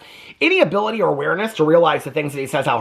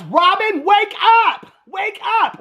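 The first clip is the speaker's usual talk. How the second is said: exasperated yell; slower than usual. Acted. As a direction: shout at her to snap out of it. Yelling at Robin on TV.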